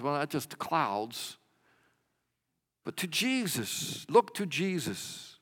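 ordinary talk, nothing marked

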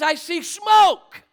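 high, loud cry of alarm, imitated; retold scream. Wife spots smoke in the rearview mirror, imitated scream. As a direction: imitate her screaming out, stretching the words. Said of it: "she screams out".

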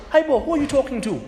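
high, fast parental rebuke; a parent snapping back at the boy; scolding parent. Acted. Father scolding his rude son, high and fast.

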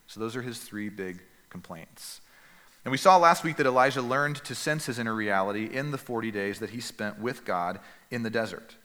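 ordinary talk

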